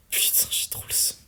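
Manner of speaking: whispered and gutted, under his breath; a real whisper of frustration at a lost item; disappointed whisper. Real, not acted. Loot not dropped, gutted.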